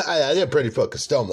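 giggling through the confession; laughing. Real, not acted. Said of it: "I forgot".

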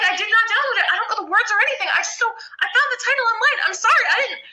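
high, rushed, panicky protest; frantic denial. Retold or imitated. Fan frantically denies piracy to the singer, high and panicked.

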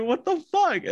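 squeaked out in amused shock; stunned disbelief. Retold or imitated. Reacting to the movie scene in the theater, high amused shock. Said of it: "I'm like".

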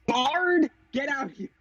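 high, drawn-out summons; high-pitched in-character order; calling out. Acted. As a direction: call the bard over, pitched well up. Character calls out the bard.

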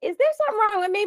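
high, fast, startled; panicked self-question; mock alarm. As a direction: rush it out in surprise. Retelling her alarm.

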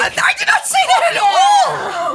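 very high, laughing shout of denial; shrieked protest. Real, not acted. Protesting a joke accusation, laughing.

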